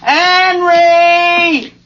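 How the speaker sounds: long, high holler of a name; yelling for someone. Acted. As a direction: holler impatiently to the next room. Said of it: "She's yelling for me".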